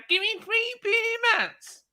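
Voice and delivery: mimicking freeloaders demanding beer mats; mocking whiny customer. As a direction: sneer at entitled customers by mimicking them. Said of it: "they say".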